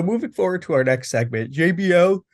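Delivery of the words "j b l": yawning mid-sentence; words stretched by a yawn. Real, not acted. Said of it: "I can't stop yawning".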